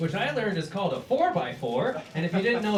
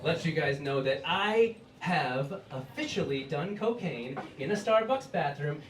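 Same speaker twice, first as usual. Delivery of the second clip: ordinary talk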